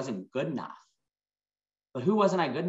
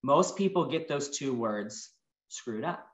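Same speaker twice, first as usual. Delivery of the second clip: normal talking voice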